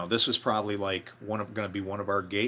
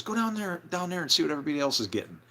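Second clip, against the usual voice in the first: higher-pitched retold command, rushing at the end; bossy order; 7 semitones higher, much faster than usual. Mark telling Jason to see what others get, bossy order. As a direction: imitate a bandleader hurrying someone along.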